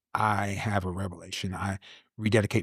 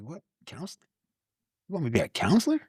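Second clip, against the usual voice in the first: incredulous; 8 semitones higher, faster than usual. Retold or imitated. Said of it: "It's like".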